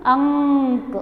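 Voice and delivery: "अंक" with a held nasal; pronunciation demo. Shown as a demonstration. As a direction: stretch the ng in "अंक" before the k. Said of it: "कैसे pronounce करना है?". ("अंक" में अनुस्वार लंबा खींचकर)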